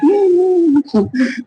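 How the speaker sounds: high-pitched burst of laughter; short fit of high laughter; amused laugh. Real, not acted. Amused by own money talk.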